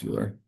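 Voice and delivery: Euler read as "YOO-ler"; former mispronunciation of Euler. Shown as a demonstration. Teacher admits an old mistake, Euler said "YOO-ler". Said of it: "I used to think it was pronounced".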